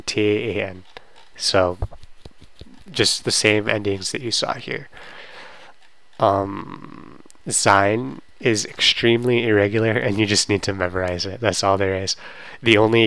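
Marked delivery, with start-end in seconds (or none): none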